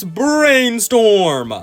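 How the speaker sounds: drawn-out, high announcer-style name call; hyped reveal. Pretend. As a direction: stretch the name out with mock grandeur. Hyped reveal of chosen alien.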